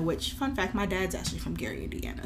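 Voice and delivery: fast, soft whisper; whispered aside. Real, not acted. Host whispering a fun fact to her co-host. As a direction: slip it in as a secret aside.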